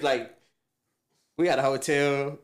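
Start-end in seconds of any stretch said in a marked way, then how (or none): none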